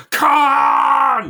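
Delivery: long, loud, high sustained scream; hammed-up starship captain's yell; Captain Kirk. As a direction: roar a name in anguished fury. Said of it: "when Captain Kirk goes".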